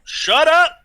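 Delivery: high, loud yell; teased outburst. Real, not acted. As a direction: shout down the teasing. Player yells back at teasing friend.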